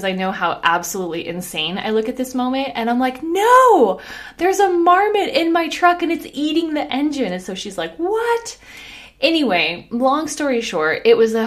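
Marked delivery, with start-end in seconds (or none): 3.2-7.3 high, loud reenacted holler; mock frantic call across the street; shouting back. Shouting back to distant neighbor. 8.0-8.6 very high imitated disbelief; high-pitched mock astonishment; incredulous call. Neighbor's disbelieving reply, called back.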